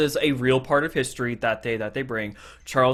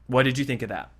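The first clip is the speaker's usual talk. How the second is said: ordinary talk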